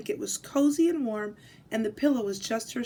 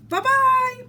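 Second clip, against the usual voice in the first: higher, louder calling bear farewell; big gruff bear voice; 13 semitones higher, 8 dB louder than usual. Acted. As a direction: call out bye-bye like a big bear. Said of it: "a big gruff voice".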